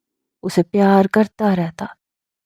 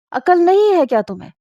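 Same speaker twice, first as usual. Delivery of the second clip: angry scolding; 9 semitones higher than usual. Retold or imitated. To his wife during the quarrel over the children, an angry high rebuke. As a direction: pitch shoots up on the rebuke.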